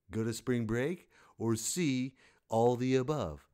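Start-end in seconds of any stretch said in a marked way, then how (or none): none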